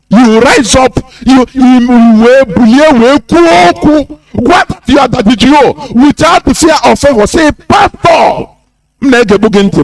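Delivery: high-pitched, insistent rallying cry; rousing shout. Real, not acted. Speaker urging people to confront the pastor, rousing shout.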